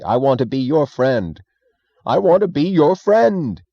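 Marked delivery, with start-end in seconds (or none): 2.1-3.6 high, loud, slow plea; crying out. Acted. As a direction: cry it out to the empty room. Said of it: "he cried to the space at large".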